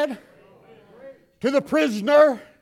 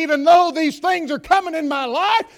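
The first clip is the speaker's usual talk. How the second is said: higher, louder declaration to God; shouted testimony; 6 semitones higher, 8 dB louder than usual. Pretend. Believer declaring to God, loud and high. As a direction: proclaim it back to God. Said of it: "you can look back at God and say".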